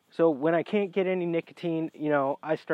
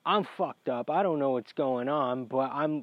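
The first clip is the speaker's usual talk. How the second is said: ordinary delivery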